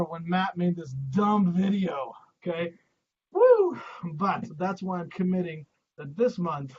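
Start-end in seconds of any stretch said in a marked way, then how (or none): 3.3-3.8 loud high whoop; excited whoop. Real, not acted. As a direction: whoop to pump the crowd. Cheer after joke.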